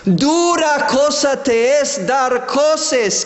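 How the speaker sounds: ordinary talk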